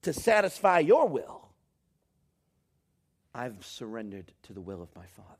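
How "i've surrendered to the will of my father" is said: hushed, breathy whisper of yielding; whispered surrender. Acted. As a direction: whisper it like a private prayer. Quoting Jesus' surrender in prayer.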